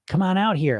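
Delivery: ordinary delivery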